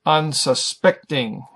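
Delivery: Unsuspecting slowly, with SPECT stressed; stress on third syllable. Shown as a demonstration. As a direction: break up Unsuspecting and lean on SPECT. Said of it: "third syllable is emphasized".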